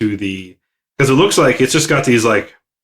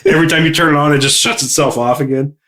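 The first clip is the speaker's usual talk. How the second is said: ordinary talk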